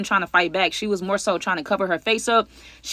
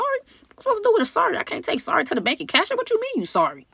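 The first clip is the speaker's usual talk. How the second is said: incredulous; 11 semitones higher than usual. Retold or imitated. Scolding Martha over the damage.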